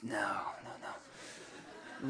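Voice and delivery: low, soft, breathy laughing no; nervous refusal. Retold or imitated. Praying person refusing God's invitation, soft laughing no. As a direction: back away, half laughing.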